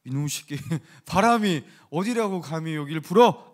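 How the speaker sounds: hammed-up scolding with loud bursts; mock scolding. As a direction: play at telling off the wind, half laughing. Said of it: "이렇게 막 꾸짖으신 걸까요?".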